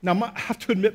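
usual speaking voice

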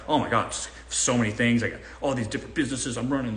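lightly voiced, fast fake-busy brag; broke guy bragging. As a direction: toss it off like a guy pretending he runs empires. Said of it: "That's my impression of every broke guy ever".

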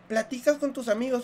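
ordinary delivery

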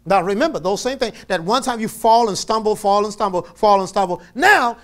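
loud, high, driving sermon voice; preaching at full force. Acted. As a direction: preach it hard to the congregation.

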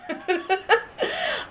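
high soft giggle; little laugh as the pup nips. Real, not acted.

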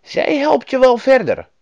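high, loud reassuring woman; kindly woman, pitched well up; Lapland woman. A narrator's reading. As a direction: encourage the child as a storybook helper. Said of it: "zei de vrouw".

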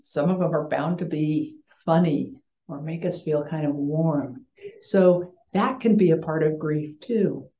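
ordinary delivery

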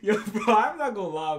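loud laughing through the words; can't stop cracking up; laughing while insisting it wasn't funny. Real, not acted.

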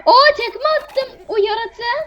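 high, slow excited call; excited gameplay. Real, not acted. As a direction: announce it with glee.

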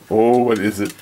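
ordinary delivery

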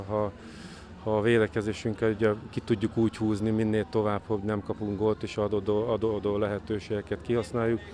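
ordinary talk

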